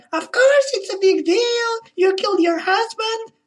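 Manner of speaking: high mocking shrill-girl voice; mock her in a high falsetto; hysterical falsetto. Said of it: "she's like".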